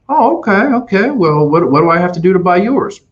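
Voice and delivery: a buyer suddenly won over, voice leaping high; surprised customer. Retold or imitated.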